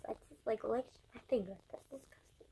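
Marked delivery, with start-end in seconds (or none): 1.7-2.5 whispered disgust. Real, not acted.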